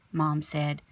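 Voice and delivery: ordinary delivery, nothing marked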